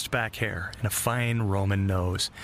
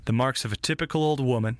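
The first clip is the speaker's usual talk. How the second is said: ordinary delivery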